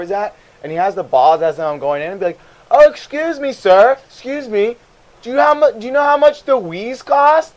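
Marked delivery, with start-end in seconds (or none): 2.7-7.5 high, loud mock customer voice; pestering customer. Customer flagging down a clerk at the side door, high and eager to ask. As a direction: pipe up like an oblivious customer.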